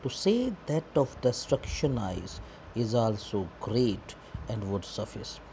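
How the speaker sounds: drawn-out, very slow reading of verse; slow poem recitation. Shown as a demonstration. Teacher reading the poem line to the class, very slowly. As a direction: read each word of the line slowly.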